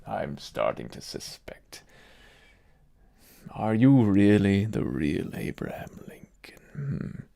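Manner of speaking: low conspiratorial whisper; suspicious whisper. Pretend. Doubting aloud whether the guest is really Lincoln, whispered. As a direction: breathe the suspicion under your breath.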